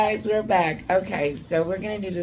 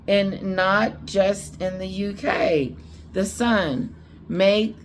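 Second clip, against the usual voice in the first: higher, slow emphatic newspaper headline read; headline read aloud; 3 semitones higher, slower than usual. Real, not acted. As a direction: read out the headlines slowly and deliberately.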